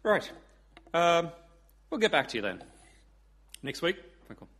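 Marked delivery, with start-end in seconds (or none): 0.0-2.6 Irish caller backing off; reply in an Irish accent. Acted. Paddy after hearing Kim's numbers, Irish accent. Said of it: "I do do an Irish accent".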